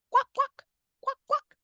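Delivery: high squeaky quacking; duck quack. Shown as a demonstration. Teacher imitates duck.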